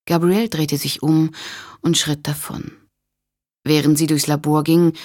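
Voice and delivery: ordinary delivery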